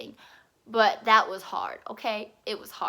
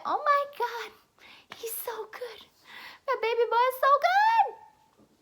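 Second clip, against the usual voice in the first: put-on very high baby-talk squeal; hammed-up high-pitched adoration; gushing over puppy; 13 semitones higher than usual.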